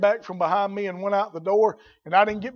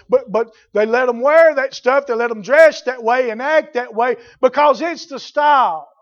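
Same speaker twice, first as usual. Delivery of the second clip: louder, higher, rising indignation; heated rebuke; 4 semitones higher, 7 dB louder than usual. Real, not acted. Preacher scolding parents who allow the style, rising loud. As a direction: build into a scolding.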